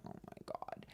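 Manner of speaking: barely voiced stunned whisper; whispered disbelief. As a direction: whisper it under your breath in amazement. Acted stunned reaction in retold story.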